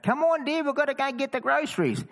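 very high-pitched mock wife voice; wife calling out. Wife calls her walking husband to get groceries, calling out. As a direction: imitate a wife calling from afar, high falsetto. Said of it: "his wife would call out".

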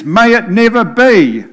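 Paul's words rung out high and loud; emphatic. Acted. Preacher quoting Paul, emphatic and loud. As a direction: thunder out the apostle's emphatic cry. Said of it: "In fact, he is emphatic".

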